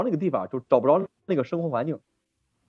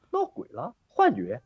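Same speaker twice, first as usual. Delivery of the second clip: mock spooked; 11 semitones higher, 4 dB quieter, slower than usual. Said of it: "我就害怕嘛".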